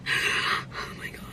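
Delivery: slow, soft despairing whisper. Real, not acted. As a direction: breathe it out, defeated.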